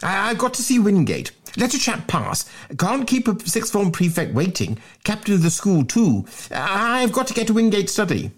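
high, insistent stammering plea; flustered schoolboy. Acted. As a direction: insist in a high voice, stuttering at the start. Stammering Bunter keeps pleading.

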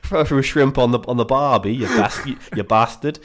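put-on light Australian accent parody; mock Australian accent. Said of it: "a proper Australian".

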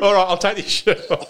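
high-pitched laughing concession; helpless real laughter in the voice. Real, not acted. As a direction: give in while cracking up. Teased about outfit, cracking up.

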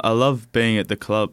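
ordinary delivery

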